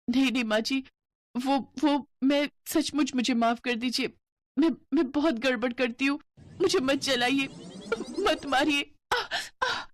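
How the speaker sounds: frightened pleading. Acted. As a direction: beg her not to burn you. Frightened bride begs.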